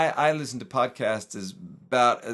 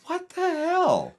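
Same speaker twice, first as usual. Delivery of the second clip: high, drawn-out what the hell; mock disbelief, stretched out slow; exasperated; much slower than usual. Complaining about talky Japanese radio.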